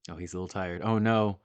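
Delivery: ordinary talk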